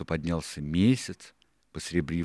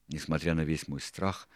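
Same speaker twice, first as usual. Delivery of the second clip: ordinary delivery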